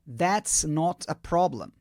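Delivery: That's not a problem, said slowly and clearly; slow model phrase. Shown as a demonstration. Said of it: "a primeira opção é". (devagar e bem articulado)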